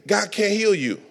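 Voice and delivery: ordinary talk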